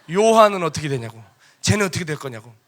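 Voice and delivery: loud, pressing retold challenge; acted-out indignant demand; insistent demand. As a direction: push the question hard, punching the first words. Peter pressing Jesus, retold.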